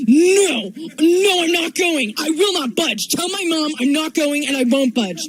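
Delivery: put-on whiny kid refusal. Babysitter quotes the boy refusing to budge, high and whiny. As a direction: play a stubborn little boy refusing.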